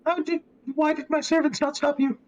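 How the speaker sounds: high-pitched indignant noblewoman; high lady voice. Acted. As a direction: scold like a grand hostess.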